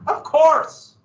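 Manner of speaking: high, loud mock agreement; sarcastic of course. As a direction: throw it out with exaggerated sarcasm. Sarcastic emphasis.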